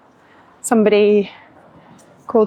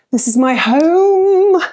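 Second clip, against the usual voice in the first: excited; 8 semitones higher, 5 dB louder, slower than usual. Real, not acted. Showing off hotel room.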